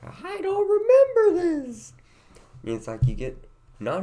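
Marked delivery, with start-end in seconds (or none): 0.0-1.9 high, slow, loud amazement; amazed. Retold or imitated. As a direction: marvel loudly at it. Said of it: "you're like".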